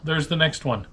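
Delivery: normal talking voice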